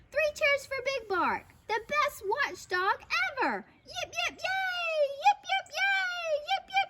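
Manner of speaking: high-pitched rallying cheer; jubilant cheer. A narrator's reading. Crowd salutes the watchdog, jubilant cheering. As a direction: squeal the hurrahs in rhythm.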